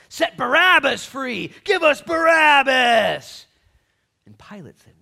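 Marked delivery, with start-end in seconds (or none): 0.1-3.5 high, slow, roaring shout; loud drawn-out crowd chant; mob's demand. Acted. Acted crowd demanding Barabbas.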